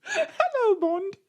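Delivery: very high, mock-British Moneypenny; put-on British accent. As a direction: flute it in a silly high British voice. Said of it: "We both have to work on our accents".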